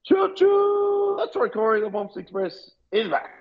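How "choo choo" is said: high tooting choo choo like a steam train; playful train call; train whistle. Pretend. Train horn imitation for Bomps Express.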